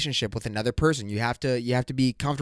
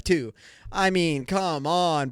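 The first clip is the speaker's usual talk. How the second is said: ordinary delivery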